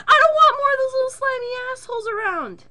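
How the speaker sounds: high, loud, crying-rant; hysterical whiny yell. Retold or imitated. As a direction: wail it in disgust. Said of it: "I'm like".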